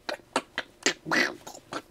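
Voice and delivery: petits claquements de bouche sans voix; imitation de clics parasites. Shown as a demonstration. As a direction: imite des artefacts audio par de discrets clics secs. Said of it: "je fais très bien les artefacts". (bruitages de clics numériques, tout bas)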